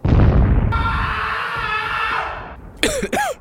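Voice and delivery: long, high loud cry of a man hit; loud pained outburst. Acted. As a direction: cry out hard as if struck. Wounded soldier in the story.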